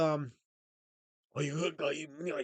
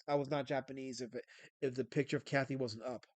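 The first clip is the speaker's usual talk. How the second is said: normal talking voice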